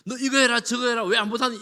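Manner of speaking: loud, high scolding parent voice; mocking a bossy nag, high and loud; nagging parent. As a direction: bark orders like a fed-up mom. Mock nagging parent, not God.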